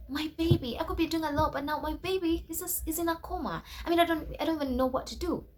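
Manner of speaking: high, pleading wail over the laptop; distressed lament. Retold or imitated. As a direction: cry out about the broken laptop as your baby. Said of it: "But then I'm like".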